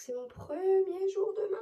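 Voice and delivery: high, loud singsong burst; excited squeal. Real, not acted. Woman telling followers about her first day at work, excited squeal.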